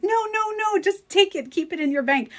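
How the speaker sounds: high, eager friend voice; excited friend. Retold or imitated. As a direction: urge her to keep the money. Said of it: "my friend's like".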